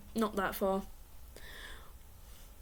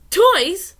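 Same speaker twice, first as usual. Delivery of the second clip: surprised; 7 semitones higher, 11 dB louder than usual. Acted. Surprised at pet having toys.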